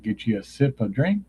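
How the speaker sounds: ordinary talk, nothing marked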